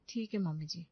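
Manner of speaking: normal talking voice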